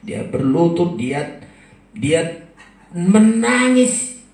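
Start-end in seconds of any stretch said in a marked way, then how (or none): 2.9-4.2 drawn-out emphasis. Acted. Preacher dwells on her weeping.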